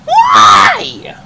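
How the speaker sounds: high, loud outraged yell; shouted why. Real, not acted. Gamer yelling at the game, outraged shout. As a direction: scream it at the screen.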